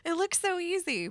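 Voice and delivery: high-pitched mock exasperation; playful replay of being fed up; retold frustration. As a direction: whine it lightly, imitating your frustrated younger self. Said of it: "just getting so frustrated".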